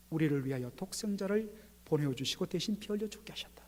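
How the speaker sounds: hushed whisper. Acted. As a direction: whisper it like a secret. Preacher drops to whisper.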